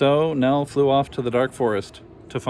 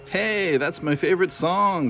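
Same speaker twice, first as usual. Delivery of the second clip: put-on teenager voice; 7 semitones higher, slower than usual. A narrator's reading. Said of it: "said the teenager".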